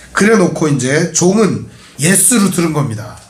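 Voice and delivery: ordinary delivery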